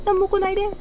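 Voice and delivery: ordinary talk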